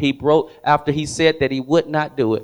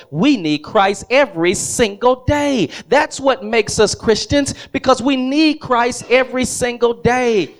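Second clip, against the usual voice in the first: preaching build; 8 semitones higher than usual. Acted. Preacher telling the congregation they need Christ daily, rising preaching. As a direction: drive the point home to the church.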